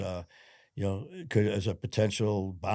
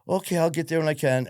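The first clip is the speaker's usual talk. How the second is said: mom's voice; 8 semitones higher, 6 dB louder than usual. Retold or imitated. Mom casual on phone.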